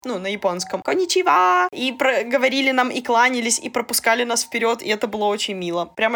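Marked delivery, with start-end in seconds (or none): 0.8-1.7 higher sweet Japanese greeting; cute bowing hello; breathy cutesy giggly. Retold or imitated. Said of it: "очень милым голосом говорили".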